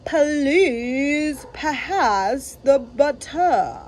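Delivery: slow, over-loud and distinct; loud, deliberate dinner announcement. Acted. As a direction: bellow each word for the table. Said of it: "in a very loud voice".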